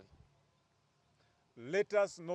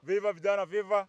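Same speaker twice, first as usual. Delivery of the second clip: loud slogan opener; rousing rally cry; rallying call; 3 semitones higher, 7 dB louder than usual. Real, not acted. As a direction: call out for the group to answer. Slogan call opening a speech.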